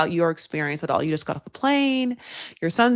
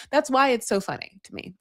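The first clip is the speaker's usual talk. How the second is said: usual speaking voice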